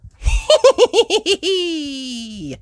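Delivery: bright laugh; real laugh. Real, not acted. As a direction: laugh with delight at the end. Narrator laughs at end.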